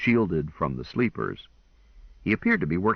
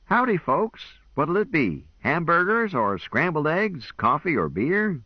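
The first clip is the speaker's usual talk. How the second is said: much higher, hearty Western howdy; folksy cowboy greeting; 10 semitones higher than usual. A narrator's reading. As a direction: greet the tourists like a drawling cowboy host. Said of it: "He greeted us with".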